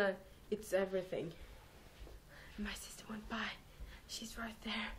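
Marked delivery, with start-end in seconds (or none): 2.6-4.9 hushed so sister won't hear; whisper. Real, not acted. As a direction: keep it secret, barely voiced. Sister nearby, hiding from her.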